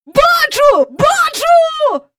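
very high, loud, excited shout; thrilled cry. A narrator's reading. Mister Banks jumping at the telescope, thrilled shouting. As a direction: cry out at the first sighting. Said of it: "аж підскочив він".

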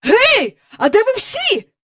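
high, excited holler; calling out. A narrator's reading. Mister Banks looking for his family, high calling out. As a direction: call through the house for everyone. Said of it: "гукнув він".

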